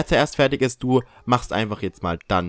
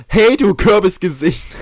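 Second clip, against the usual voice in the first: higher, louder, slow taunting jab; mock insult; 7 semitones higher, 7 dB louder, slower than usual. Shown as a demonstration. Trying out a new insult on the scarecrow, mock taunt. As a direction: sneer it out like a playground taunt. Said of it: "neue Beleidigung".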